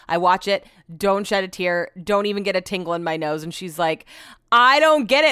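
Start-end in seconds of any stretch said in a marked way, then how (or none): 4.5-5.3 high, loud mock bafflement; incredulous and loud; baffled protest. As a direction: retell it as an exasperated, raised-pitch complaint. Retelling a baffled argument over crying.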